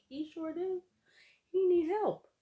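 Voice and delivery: high, mocking he sure do; sassy agreement. Vlogger agreeing with the wife about him, sassy and high. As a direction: chime in with a cheeky yes. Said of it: "I was like".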